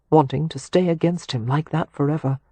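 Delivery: ordinary delivery, nothing marked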